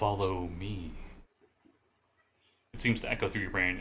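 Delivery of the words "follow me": low, slow, drawn-out mental summons; soft telepathic command, stretched out; voice inside the head. Acted. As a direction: speak into their brain, low and lingering. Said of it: "forcibly talking in your, in your brain".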